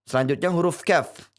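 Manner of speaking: ordinary delivery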